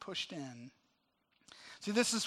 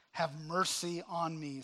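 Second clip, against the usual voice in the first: usual speaking voice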